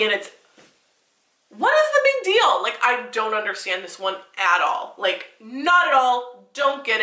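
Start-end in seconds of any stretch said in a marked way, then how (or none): 1.5-2.6 exasperated outburst. Real, not acted. Name consultant rants at bad advice.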